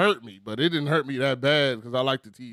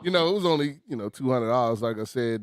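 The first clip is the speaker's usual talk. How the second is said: usual speaking voice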